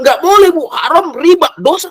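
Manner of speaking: loud and high, scolding his mother; harsh scolding. Shown as a demonstration. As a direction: snap the verdict at her like a reproach. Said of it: "Pelan-pelan, Ibu".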